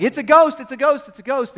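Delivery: high loud panicked cries; frightened crying out. Retold or imitated. Disciples in the boat crying out in fright. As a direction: cry out in terror at the ghost. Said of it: "they're crying out".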